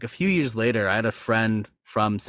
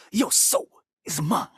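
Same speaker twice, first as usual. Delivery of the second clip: higher, snappier villain take; Mortal Kombat 11 version; 7 semitones higher than usual. Retold or imitated. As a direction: deliver it quick and sharp. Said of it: "the M K eleven one".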